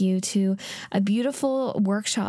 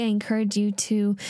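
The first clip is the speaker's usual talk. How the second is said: ordinary talk, nothing marked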